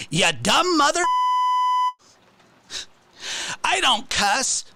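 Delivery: high, jeering mock insult.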